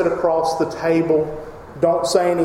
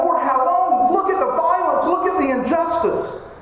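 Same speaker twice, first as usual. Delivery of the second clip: much higher, anguished crying out; 8 semitones higher than usual. Acted. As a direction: wail at God over the violence. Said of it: "he's crying out".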